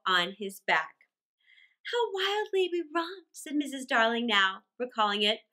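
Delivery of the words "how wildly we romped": high lady's voice, wistful; wistful mother. A narrator's reading. Mother recalling the romp with her husband, high and wistful. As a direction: lift it high in fond recollection.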